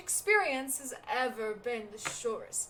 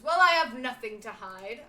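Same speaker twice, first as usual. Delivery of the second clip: louder, huffy denial; defensive outburst; 13 dB louder than usual. Acted. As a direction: snap back like a merchant with something to hide. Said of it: "Okay, dude, calm down, man".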